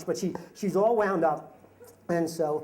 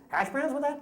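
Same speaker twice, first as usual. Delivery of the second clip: burger clerk; 9 semitones higher than usual. Retold or imitated. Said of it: "And the guy goes".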